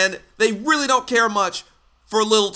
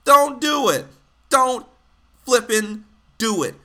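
emphatic warning; 6 semitones higher than usual. Real, not acted. Telling people not to defend stupidity.

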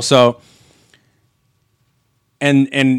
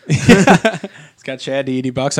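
laughing; 3 semitones higher, 3 dB louder, much slower than usual. Real, not acted. Joking about the play's title.

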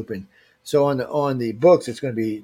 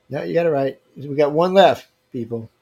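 usual speaking voice

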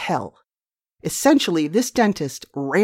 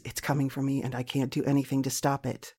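fearful inner thought; 3 semitones lower, 9 dB quieter than usual. Retold or imitated. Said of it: "all I could think of was".

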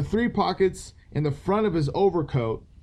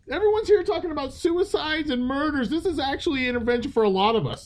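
high, fast mock rant; excited rant; 10 semitones higher, faster than usual. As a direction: rattle it off, pitched up. Mock complaint.

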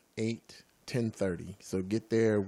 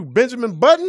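laughing shout; 14 semitones higher, 13 dB louder than usual. Real, not acted. Joke shouted through laughter.

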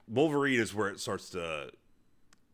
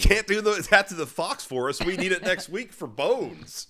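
mock studio exec; 7 semitones higher, 7 dB louder than usual. Studio exec to filmmakers protecting the Fox Forest, hammed-up higher protest. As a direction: ham up a fussy studio exec guarding its forest.

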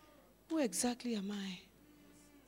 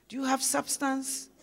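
ordinary delivery

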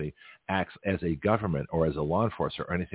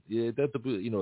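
ordinary talk, nothing marked

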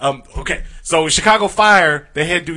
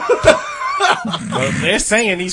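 laughing; 3 dB louder, much slower than usual. Real, not acted. Laughing through the line.

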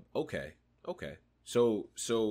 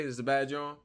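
normal talking voice

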